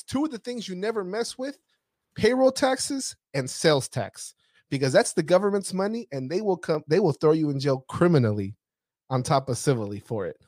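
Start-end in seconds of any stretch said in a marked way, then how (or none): 0.0-6.1 warning clients about payroll and sales tax, emphatic; stern warning to clients. Retold or imitated. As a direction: lay down the rule you never break. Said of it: "I tell my clients".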